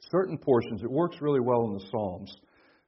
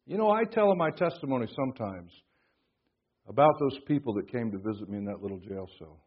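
ordinary talk

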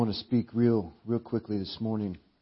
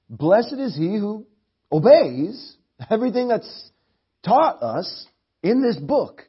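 higher, louder, slow and urgent; fervent preaching; 7 semitones higher, 8 dB louder, slower than usual. Acted. Preacher urging the church toward blessing, fervent and slow. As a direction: press the blessing home.

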